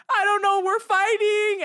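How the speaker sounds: high, loud mock whine; retold distressed wail. Explaining the fight to security guards, mock wailing. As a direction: imitate an upset, wailed reply. Acted tearful reply during quarrel.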